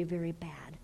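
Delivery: a soft, heavy whisper; dramatic whisper. Acted. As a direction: drop to a whisper. Preacher whispers for emphasis.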